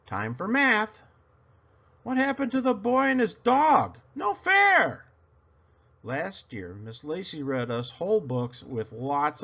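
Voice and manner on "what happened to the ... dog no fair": much higher, louder protest; indignant kid. A narrator's reading. Girl upset the teacher stopped the story, high and outraged. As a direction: cry out like a cheated child.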